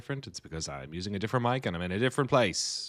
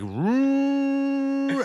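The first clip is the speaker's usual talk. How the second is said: imitated whoosh; 6 dB louder than usual. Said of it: "And it's like".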